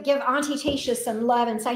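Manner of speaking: normal talking voice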